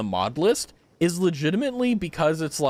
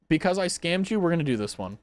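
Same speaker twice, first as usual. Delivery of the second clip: normal talking voice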